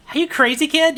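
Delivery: high, loud, wary barkeep voice; nervous bartender. Acted. Bartender to armed stranger, high and anxious. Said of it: "the bartender goes".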